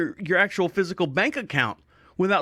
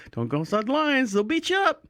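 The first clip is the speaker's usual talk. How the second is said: rising higher pitch through the warning; mock warning; 6 semitones higher than usual. Coloring voter warns himself about the lines, mock alarm.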